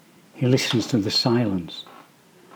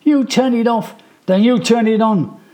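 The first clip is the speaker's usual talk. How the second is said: high, loud defiant shout; yelling back; 11 semitones higher, 4 dB louder than usual. A narrator's reading. Brother shouting back about the light switch. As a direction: throw the dare back hard. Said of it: "he yells back".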